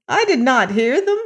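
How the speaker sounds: put-on high-pitched old woman, protesting; high, surprised old-woman voice; old woman exclaiming. A narrator's reading. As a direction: insist in a high old mother's voice. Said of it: "exclaimed the old woman".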